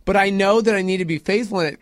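ordinary talk

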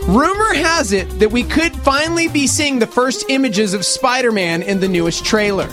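normal talking voice

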